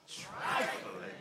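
hushed, low whispered admonishment; said like mother. Retold or imitated. Mother warning her child not to be trifling, whispered. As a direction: drop to a whisper for the damning word. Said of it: "you gotta say it like she said it".

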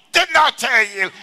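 high, loud, slow scolding mama voice; mock angry mama. Mother snapping at the child who wants nicer tone, mock angry yell. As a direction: play the fed-up mother snapping back.

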